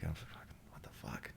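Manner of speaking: very soft, whispered retold reaction; whispered disbelief. Actor recalls reacting to the strange call, whispered disbelief.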